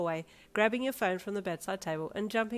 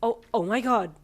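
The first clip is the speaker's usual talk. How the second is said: louder breathless gasp; 4 semitones higher, 8 dB louder than usual. Acted. Teen realizing the news, gasping louder. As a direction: catch your breath in shock. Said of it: "you gasped".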